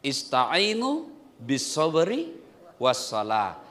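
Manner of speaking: usual speaking voice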